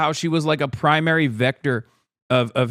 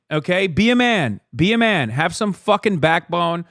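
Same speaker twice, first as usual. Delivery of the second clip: ordinary delivery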